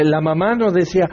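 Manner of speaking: normal talking voice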